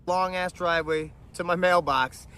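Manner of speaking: ordinary talk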